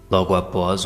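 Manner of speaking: ordinary delivery, nothing marked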